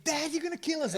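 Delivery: high, loud mock child scream; kids screaming. Kids screaming at dad while he drives, mock panic. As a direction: imitate panicking kids in the back seat. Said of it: "the kids start screaming".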